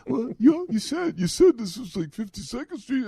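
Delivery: high-pitched, put-on cabbie voice; driver impression. Driver arguing with passenger over the address, mock driver impression. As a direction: imitate a protesting driver in a high, loud voice. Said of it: "Is that how he talked?".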